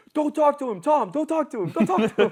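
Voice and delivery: very high, loud yelled warning; angry neighbor yelling to Tom not to talk to the salesman. Retold or imitated. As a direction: shout at Tom across the yard, high and loud. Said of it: "You hear the guy".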